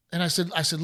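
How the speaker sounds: usual speaking voice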